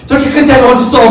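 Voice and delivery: 높고 크게 투덜대는 초조한 아버지; 속 터져 높은 목소리로 퍼붓듯; 짜증 난 야이로. Retold or imitated. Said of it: "막 속으로". (여인 때문에 늦어진 야이로가 속으로 높게 짜증 내며)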